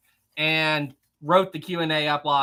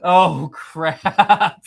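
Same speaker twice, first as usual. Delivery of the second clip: laughing; 7 dB louder, much slower than usual. Real, not acted. Laughing at a surprise super chat.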